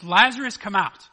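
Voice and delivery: calling loudly into the tomb, full voice; loud cry. Acted. Jesus shouting to dead Lazarus in the tomb, loud cry. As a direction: shout the name so it carries. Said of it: "Jesus cries out with a loud voice".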